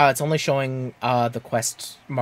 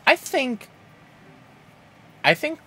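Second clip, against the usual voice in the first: very high squeaky false start; cartoon mouse falsetto; Mickey Mouse; 12 semitones higher, 3 dB louder, slower than usual. Player starts an opinion in Mickey Mouse voice. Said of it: "I don't think he talked like Mickey Mouse".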